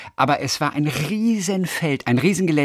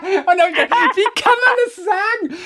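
laughing hard; slower than usual. Real, not acted. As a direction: laugh through the words, slowly. Cracking up at own story.

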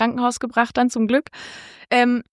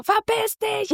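much higher pitched yell; mock yell; 10 semitones higher, slower than usual. Boyfriend yelling at the bloody man, high. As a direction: shoo the intruder off in a high voice.

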